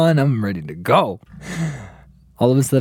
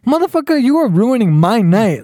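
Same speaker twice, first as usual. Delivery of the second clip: high-pitched mock outrage; retold anger; 10 semitones higher than usual. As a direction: rant at the friend who ruined your night. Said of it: "I'm starting to get mad".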